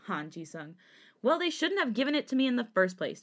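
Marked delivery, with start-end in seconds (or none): 1.2-3.2 higher, defensive retort; raised voice. A narrator's reading. Jisung defends himself against cousin, voice raised. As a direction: fire back with moderately raised voice. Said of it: "Jisung also raised his voice".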